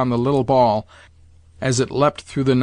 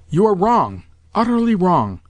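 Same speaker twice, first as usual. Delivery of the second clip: high, insistent rebuttal; indignant, raised-pitch insistence; emphatic contradiction; 6 semitones higher than usual. A narrator's reading. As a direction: contradict him flatly and heatedly. Excited grandmother contradicts his warning.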